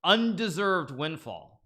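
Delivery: "undeserved windfall" drawn out slowly with weight on undeserved; highlighted word. Real, not acted. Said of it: "this one word that I'm highlighting".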